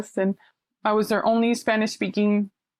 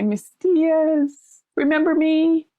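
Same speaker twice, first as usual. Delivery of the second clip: higher and louder, bright recognition call; excited greeting; 7 semitones higher, 4 dB louder, slower than usual. Retold or imitated. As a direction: call out her name, thrilled to see her. Youth greeting her in public.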